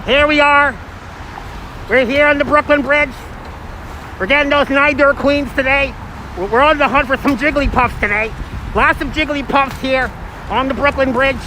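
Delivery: playful high Pokemon streamer voice. Shown as a demonstration. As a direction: pitch it up like a Pokemon hunt host. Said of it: "You wanna hear the Pokemon voice?".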